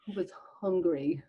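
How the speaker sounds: soft, slow, whispered bedtime read; sleep story voice. Shown as a demonstration. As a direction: ease the listener into sleep. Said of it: "listen to mine".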